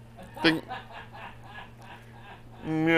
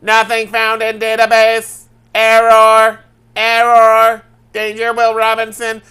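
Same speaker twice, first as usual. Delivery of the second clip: loud, raised robot readout; glitching old sci-fi computer; computer processing; 4 semitones higher, 5 dB louder than usual. Pretend. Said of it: "are you the computer from".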